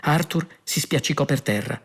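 ordinary delivery, nothing marked

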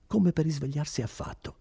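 ordinary delivery, nothing marked

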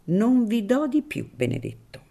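put-on a wary old priest keeping his distance; Don Cirillo, wary priest.

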